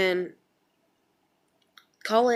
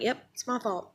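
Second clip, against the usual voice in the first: higher, mock-agreeing sarcasm; sarcastic; 5 semitones higher than usual. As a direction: brush them off with fake agreement. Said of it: "just to get them to shut up".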